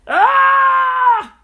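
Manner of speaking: very high, loud startled cry; tripping yelp. Acted. Landlord trips on a rock, high loud yelp. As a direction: shriek as you trip on the rock. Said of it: "cried the landlord".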